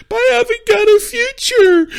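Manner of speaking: loud, high, drawn-out mock wail; hammed-up tearful whine, slow and piercingly high; badly faked bawling; fake crying. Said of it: "didn't notice how fake her tears were".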